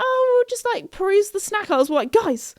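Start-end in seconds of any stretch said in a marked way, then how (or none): 0.0-1.7 high, airy, oblivious voice; super chill. Retold or imitated. Locked-in friends on the phone, super chill. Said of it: "super chill about it". 2.1-2.5 high, urgent plea; freaking out. Retold or imitated. Phoning trapped friends, frantic warning. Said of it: "we were freaking out".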